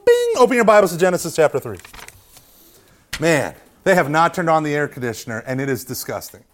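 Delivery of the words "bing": very high, short "bing"; ding like a jingle's closing chime; imitated chime.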